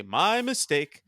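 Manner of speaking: slow "My mistake" with weight on "My"; slow, deliberate "My mistake" quoting the show; quoted inflection. Retold or imitated. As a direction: drawl out "My mistake", leaning on "My". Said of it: "why I use that inflection".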